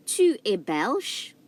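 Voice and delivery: "Tu es belge." slow, tu lifted; slow French demo. Shown as a demonstration. Teacher repeating the sentence slowly for students. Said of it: "I'm gonna repeat these two sentences again".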